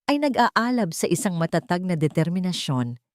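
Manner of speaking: plain reading voice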